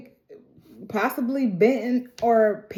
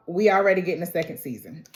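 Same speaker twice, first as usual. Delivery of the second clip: normal talking voice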